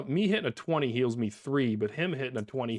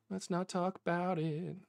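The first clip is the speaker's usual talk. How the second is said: higher, slower, drawn out; sing-song higher repeat; 5 semitones higher, 7 dB quieter than usual. Real, not acted.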